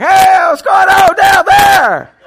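high, slow, stretched shout into a cell phone; yelling into a phone. Retold or imitated. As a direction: holler so it carries to Guatemala. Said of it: "I gotta yell louder in the cell phone".

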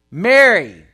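Jesus calls Mary by name; calling her name. Acted. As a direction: call "Mary" across to her.

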